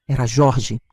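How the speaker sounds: ordinary delivery, nothing marked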